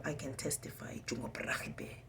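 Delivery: hushed whispered vow; whispering. Real, not acted. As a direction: drop to a bare whisper. Sudden drop to a whisper.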